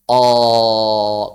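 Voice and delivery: long, low heavy "Oh"; hollow the tongue and raise its back on a drawn-out "Oh"; thick fathah. Shown as a demonstration. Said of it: "fathah tebal begini".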